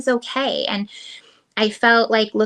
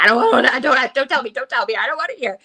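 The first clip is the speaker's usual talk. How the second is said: a hint of a distressed daughter, higher; mock pleading, don't tell me; lightly voiced upset girl refusing to listen; 3 semitones higher, much faster than usual. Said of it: "and Gabby's like".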